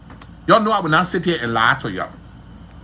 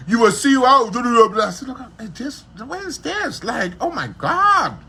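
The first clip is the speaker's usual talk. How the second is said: mock disgusted reaction; 7 semitones higher than usual. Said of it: "then he said".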